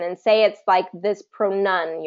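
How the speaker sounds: usual speaking voice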